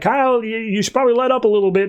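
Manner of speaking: high-pitched hesitant suggestion; nervous caution. Retold or imitated. Carol telling Kyle to ease off, mock nervous.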